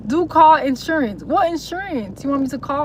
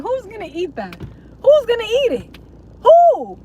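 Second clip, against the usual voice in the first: exasperated; 7 semitones higher than usual. Real, not acted. Ranting at big chocolate bars.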